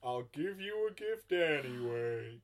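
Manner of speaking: put-on American Santa, swooping high then settling; magnanimous hammed-up Father Christmas; bad Santa. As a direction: grant a favour as a terrible Santa. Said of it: "This is the worst Santa".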